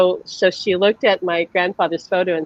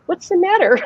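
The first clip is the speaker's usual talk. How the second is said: put-on puzzled child; 9 semitones higher than usual. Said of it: "He'd say".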